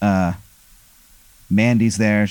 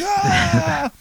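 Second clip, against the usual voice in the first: a high, drawn-out cheering "ah"; crowd cheer "ah". Acted. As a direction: whoop like the whole room erupts. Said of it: "And everyone's like".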